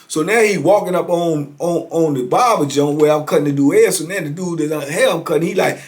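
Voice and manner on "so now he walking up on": usual speaking voice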